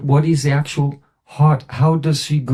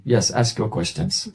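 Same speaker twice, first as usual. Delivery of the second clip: quiet, slow and calm; calm invitation; 5 semitones lower, 5 dB quieter than usual. Retold or imitated. Guru calmly invites the scholar to ask, softly. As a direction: invite him gently to begin.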